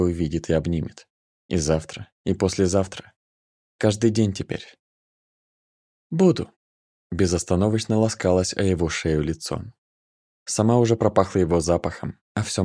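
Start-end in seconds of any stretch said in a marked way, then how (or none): 6.1-6.5 put-on tender girl voice. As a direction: coo it, pitched high. Nika, high girl voice.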